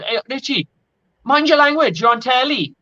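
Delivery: high, lilting Welsh referee telling-off; Welsh accent. Retold or imitated. Welsh referee scolding the swearing captain mid-final, in a Welsh accent. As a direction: imitate a Welsh ref scolding, pitched well up. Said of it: "in his Welsh accent".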